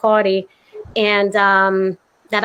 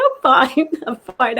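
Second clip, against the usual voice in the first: embarrassed laugh; 3 semitones higher than usual. Retold or imitated. I was so embarrassed.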